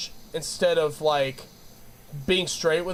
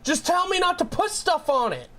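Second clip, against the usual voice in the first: exasperated retort; 12 semitones higher than usual. Retold or imitated. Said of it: "And I was like".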